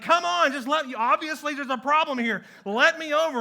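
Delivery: loud, high, exasperated yelling at traffic; frustrated shout. Retold or imitated. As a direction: shout at the cars that won't let you over. Begging drivers to let him over.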